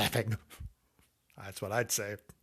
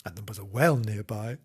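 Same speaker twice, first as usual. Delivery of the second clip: ordinary delivery, nothing marked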